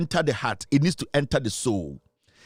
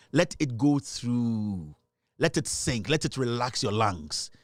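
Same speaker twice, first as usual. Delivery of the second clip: mock meditation; 4 semitones lower, slower than usual. Pundit coaxing uptight rival fans in a mock meditation. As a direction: guide the fans into a pretend calm.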